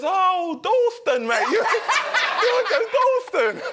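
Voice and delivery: high, laughing Cockney "Dalston, mate"; Cockney correction. Retold or imitated. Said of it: "He goes".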